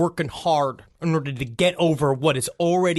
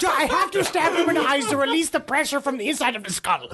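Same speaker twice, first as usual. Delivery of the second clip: mock old-timey doctor; 7 semitones higher than usual.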